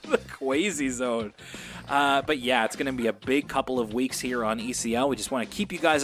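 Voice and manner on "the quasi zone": slow and laughing, savoring it; laughing, teasing repetition. Real, not acted. Repeating the joke name laughing.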